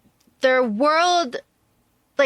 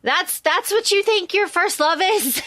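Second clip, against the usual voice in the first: mock outrage, pitched way up; incredulous; 9 semitones higher than usual. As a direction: squeal it in disbelief, you can't believe her choice. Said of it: "you're just like".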